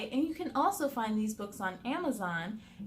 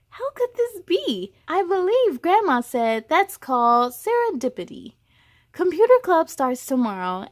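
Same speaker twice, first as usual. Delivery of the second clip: lively picture-book read-aloud, a touch louder than chat; expressive children's story reading; story narration; 4 semitones higher, 8 dB louder than usual. A narrator's reading. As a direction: read it to a room of kids.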